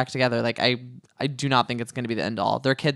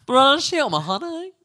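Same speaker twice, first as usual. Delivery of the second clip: exaggerated British accent; 12 semitones higher than usual. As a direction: ham up a posh British lady with a high "honey". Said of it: "British accent was so bad right there".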